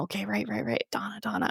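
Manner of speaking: hushed mock memorizing; acted self-reminder. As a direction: quietly rehearse the name as if to yourself. Repeating a name privately to memorize it.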